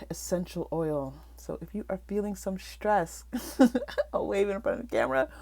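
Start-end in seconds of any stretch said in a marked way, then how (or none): 3.3-4.1 a quick, higher, louder giggle; short laugh. Real, not acted. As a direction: laugh at your own joke. Laughs at her stress joke.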